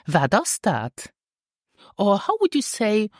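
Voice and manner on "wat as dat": "Wat as dat" run together as one linked phrase; more natural. Shown as a demonstration. Teacher shows learners the natural version, words linked. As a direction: say "Wat as dat" joined up, without gaps. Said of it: "more naturally".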